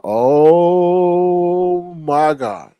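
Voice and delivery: hugely drawn-out, dismayed reaction; exasperated disbelief. Retold or imitated. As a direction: stretch it out very slowly in mock dismay. Teller reacts to the escalation.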